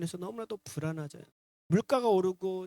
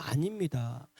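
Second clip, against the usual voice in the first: normal talking voice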